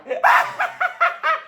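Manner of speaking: loud shrieking mad-scientist laugh, way up high; maniacal cackle. Retold or imitated. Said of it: "Sorry if I'm getting too loud".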